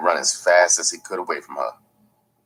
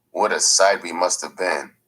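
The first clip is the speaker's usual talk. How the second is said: ordinary delivery, nothing marked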